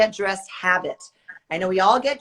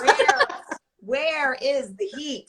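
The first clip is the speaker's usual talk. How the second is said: laughing; 5 semitones higher, much slower than usual. Real, not acted. Joking about cold northeast weather.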